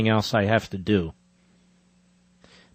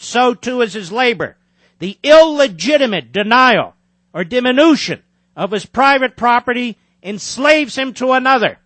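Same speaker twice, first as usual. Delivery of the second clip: emphatic book reading, higher and louder than his chat; reading aloud; 8 semitones higher, 3 dB louder than usual. Acted. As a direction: read your own book like a speech. Reads own book aloud.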